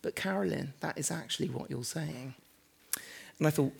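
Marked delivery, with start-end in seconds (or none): 0.0-2.3 imitating the editor's soft, much quieter steel; the editor quietly overruling the author, in a soft hush. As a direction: drop almost to a hush, calm and firm. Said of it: "she sort of just said very quietly".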